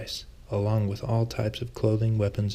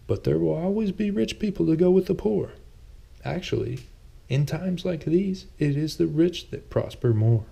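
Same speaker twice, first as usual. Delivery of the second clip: Thomas, a touch sheepish; 8 semitones higher than usual. A narrator's reading. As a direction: play Thomas a little defensive, pitched up. Thomas voiced by narrator.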